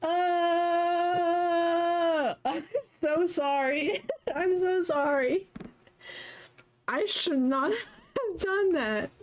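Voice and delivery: high, laughing apology; laugh-cry through sorry; embarrassed laughing. Real, not acted. Embarrassed after loud burp.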